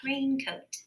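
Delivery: 语调略高地再读一遍 raincoat. Shown as a demonstration. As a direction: lift raincoat slightly, like a summary. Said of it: "I'm gonna have the long A sound".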